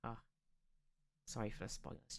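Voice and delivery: ordinary talk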